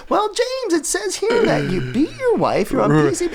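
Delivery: high put-on interviewer, ending in a shout; spring the accusation; mock interviewer. Interviewer grilling [name], mock prim voice. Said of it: "You know".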